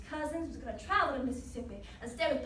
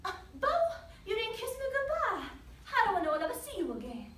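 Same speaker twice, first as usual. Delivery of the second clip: higher voice, a touch of worry; motherly pleading; 7 semitones higher than usual. Acted. Mother asking her son for a goodbye kiss, pleading.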